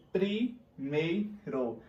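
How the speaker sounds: "primeiro" bem lento, em três sílabas; sílabas separadas. Shown as a demonstration. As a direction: separe cada sílaba de "primeiro", com pausas. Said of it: "Eu tenho três sílabas". ("primeiro" silabado devagar)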